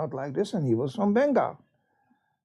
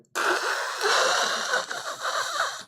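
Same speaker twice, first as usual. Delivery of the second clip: high squeal of laughter; 3 dB quieter than usual. Real, not acted. Recalling the unbearable chili.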